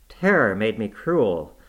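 ordinary delivery, nothing marked

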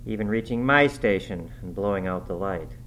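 ordinary delivery

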